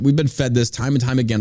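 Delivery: ordinary delivery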